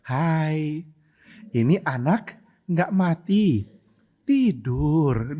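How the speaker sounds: slow, mock-gentle delivery; nice and gentle. Shown as a demonstration. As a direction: say it sweetly, stretching each word. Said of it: "ngomongnya baik-baik". (baik-baik, pelan mendayu)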